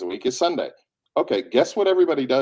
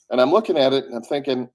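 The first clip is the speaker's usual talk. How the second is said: normal talking voice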